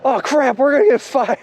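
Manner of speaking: high-pitched mock panic; freaking out. Coworker fearing he'll be fired, acted-out high panic. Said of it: "he started freaking out".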